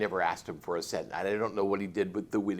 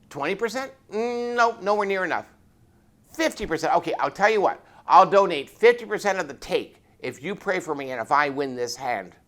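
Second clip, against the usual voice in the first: louder, higher bargaining; eager haggling; 8 semitones higher, 10 dB louder than usual. Retold or imitated. Sergeant haggling with the reverend over God's share, loud and eager. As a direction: bid up the offer like at a market.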